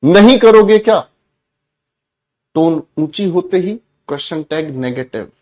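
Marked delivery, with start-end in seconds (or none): 0.0-1.1 loud, high-pitched command with a threat; high tone order. Shown as a demonstration. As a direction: bark the order, then challenge. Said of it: "फिर से ध्यान से समझना". (ऊँची tone में धमकी भरा आदेश)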